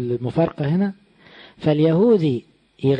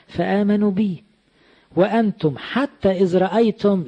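emphatic scripture rebuke; 6 semitones higher, 3 dB louder, slower than usual. Acted. Preacher quoting Christ's rebuke.

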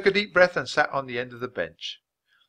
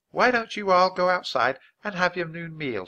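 higher-pitched, kindly teacher voice; encouraging schoolteacher; 8 semitones higher, 4 dB louder than usual. Acted. Teacher opening the first lesson, encouragingly. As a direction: invite the pupil to begin.

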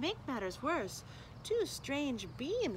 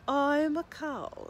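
slow cow voice; 6 semitones higher, 6 dB louder, slower than usual. Acted. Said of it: "said the cow".

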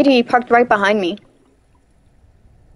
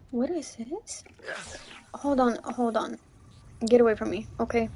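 hushed gasp; 13 dB quieter than usual. Real, not acted. Player meeting a strange creature, hushed and scared. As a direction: breathe it out, scared.

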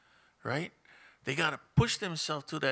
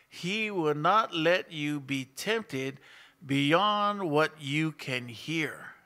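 slow, loud, deliberate Bible reading; scripture reading; 5 dB louder, much slower than usual. Acted. Pastor reading verse to church, slow and loud. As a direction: read each phrase slowly to the congregation.

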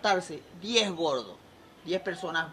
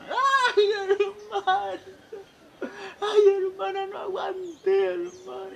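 loud falsetto wail, stretched out; hammed-up bawling to a sister; mock crying; 7 dB louder, much slower than usual. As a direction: wail slowly and very high. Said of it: "la ve llorando".

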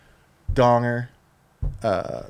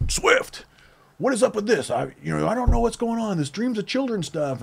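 higher, flustered boss voice; confused boss; 9 semitones higher than usual. Retold or imitated. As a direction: demand answers like a baffled boss. Boss confronts him.

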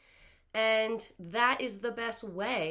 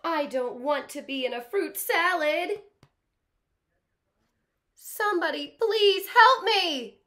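high, anxious, slowed crocodile fretting; worried crocodile; 6 semitones higher, 7 dB louder than usual. Acted. Teacher reading the crocodile's fears aloud, anxious pleading. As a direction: fret and plead like a scared little croc.

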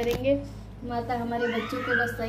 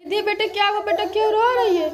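calling out worried; 9 semitones higher, 5 dB louder than usual. Real, not acted. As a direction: reach the upset daughter across the room. Mother calls to crying daughter.

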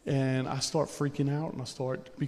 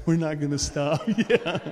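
laughing; 2 semitones higher, 5 dB louder than usual. Real, not acted. Laughing at his own joke.

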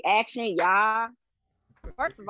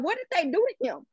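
alarmed; 8 semitones higher, faster than usual. Retold or imitated. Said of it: "So I'm like".

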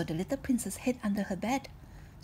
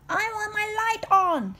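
put-on high, slow little girl plea; a small princess begging to keep the light on; 14 semitones higher, 9 dB louder, slower than usual. Princess begs her dad, high and pleading.

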